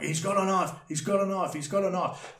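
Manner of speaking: high, breathless repeating; panicked repeating. Retold or imitated. Still to the police, high frantic repeating. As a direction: keep blurting it in panic. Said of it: "That's all I could say".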